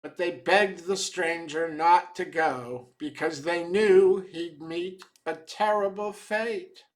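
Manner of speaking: usual speaking voice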